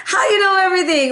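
high, fast, gushing amazement; amazed admirer. Retold or imitated. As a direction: squeal it like an awed fan. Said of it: "They're like".

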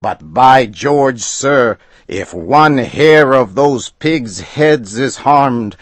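high, loud threatening outburst; angry threat. A narrator's reading. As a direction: threaten him with the law. Customer threatens the agent.

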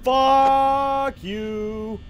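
high, loud, drawn-out curse; frustrated yell. Real, not acted. Player cursing at the game, frustrated yell.

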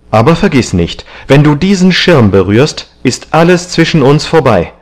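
high, loud, vehement threat; furious. A narrator's reading. Young man threatening to end the friendship with the painter, furious. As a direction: lay down an angry ultimatum. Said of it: "tatsächlich blass vor Wut".

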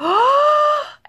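sharp high gasp of disbelief; shocked gasp. Retold or imitated. Reliving reading the invite email, a sharp shocked gasp. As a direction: suck in air as if reading amazing news. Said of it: "was literally like".

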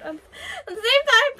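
high, loud tearful wail. Real, not acted. Fan missing a beloved character, tearful outburst. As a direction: let it burst out high, on the edge of crying.

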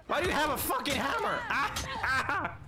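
high, fast, startled protest; alarmed outburst. Real, not acted. Player attacked with a hammer, high rushed protest. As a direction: blurt it out in high-pitched panic.

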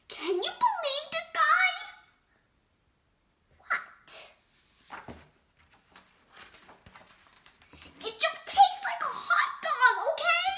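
exasperated pigeon. Acted. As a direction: lose patience with the duckling.

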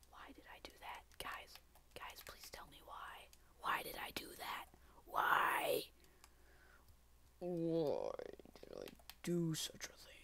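hushed, breathy lament to himself; whispered despair. Real, not acted. Gamer whispering to viewers why he messed up. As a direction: whisper it, crushed by the mistake.

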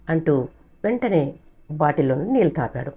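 usual speaking voice

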